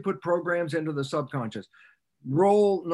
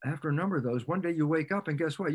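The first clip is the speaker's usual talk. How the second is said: ordinary talk, nothing marked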